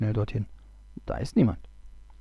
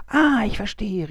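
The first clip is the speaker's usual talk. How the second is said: higher, eager exclamation; called out; 9 semitones higher, 4 dB louder than usual. Acted. The Abgucker suddenly gets it and calls out, eager and higher. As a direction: burst out as the idea lands. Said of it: "rief der Abgucker".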